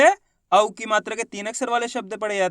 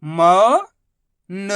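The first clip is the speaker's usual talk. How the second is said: मौन split into मौ, long pause, न; sound by sound; much slower than usual. Shown as a demonstration. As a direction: stretch मौ upward, pause, then न alone. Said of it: "उच्चारण करके आपस में लाके".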